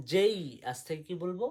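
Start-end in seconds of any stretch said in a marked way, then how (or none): 0.1-0.4 "যেই" with a full ay-ee glide. Shown as a demonstration. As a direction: end "যেই" on a clear i. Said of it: "যেটাকে কি বলবো?". ("যেই" শেষে স্পষ্ট ই দিয়ে)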